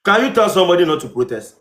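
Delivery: ordinary talk, nothing marked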